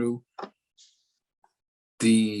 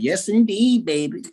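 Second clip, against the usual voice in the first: put-on high, louder Southern old-folks exclamation; Southern old folks' saying; 8 semitones higher, 3 dB louder than usual. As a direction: sing out the phrase like an old Southern elder. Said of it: "They be like".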